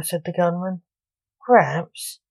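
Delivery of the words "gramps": a mild whiny complaint; complaining. A narrator's reading. Son complaining to his father, mild whine. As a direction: lightly whine the word. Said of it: "complained Lance".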